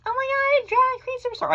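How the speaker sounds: high, loud squealing mock voice; mocking falsetto. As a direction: squeal it like a gushing gossip. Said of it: "But I hate people who are like".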